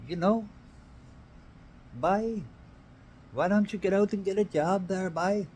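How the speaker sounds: high, lightly attempted Irish accent; a half-hearted stab at an Irish accent; failed Irish accent. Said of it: "I can't do an Irish accent".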